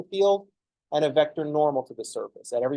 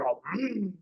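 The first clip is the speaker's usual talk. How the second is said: hammed-up musing with the pitch pushed higher; overdone thoughtful hmm; 5 semitones higher, 6 dB quieter than usual. Shown as a demonstration. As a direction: overplay the thinking on purpose. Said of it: "Don't overdo it".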